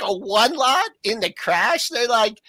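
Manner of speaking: high, mocking disbelief; incredulous floor traders. Floor traders mocking a one-lot order in the crash, high disbelief.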